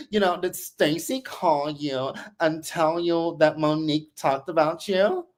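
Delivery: imitating Sharice on the phone. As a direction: play her sweet-talking Chris on the call. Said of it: "So Sharice calls Chris".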